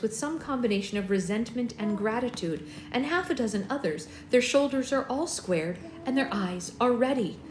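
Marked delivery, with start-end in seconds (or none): none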